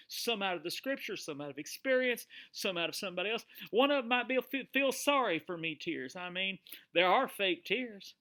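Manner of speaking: normal talking voice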